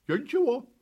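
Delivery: high, loud denial; protesting puppy. A narrator's reading. (щенок оправдывается)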